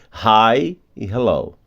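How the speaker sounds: usual speaking voice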